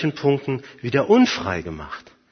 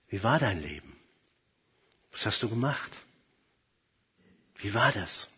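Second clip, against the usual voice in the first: low, soft, measured questions with long pauses; quiet divine questioning; 5 semitones lower, 10 dB quieter than usual. Acted. God asks a soul before his throne about its life, quiet and grave. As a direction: ask softly, leaving silence after each question.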